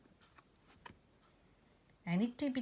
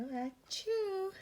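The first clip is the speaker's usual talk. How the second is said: high squeaky sneeze; comic achoo; 14 semitones higher than usual. Acted. Bear sneezes again.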